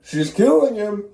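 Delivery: alarmed cry. Retold or imitated. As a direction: shout it out in alarm. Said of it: "And she's like".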